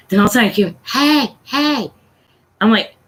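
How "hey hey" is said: put-on higher, insistent child's call; little kid. Little kid trying to get an adult's attention, mimicked child voice. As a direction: imitate a kid pestering for attention. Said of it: "I can hear this little kid".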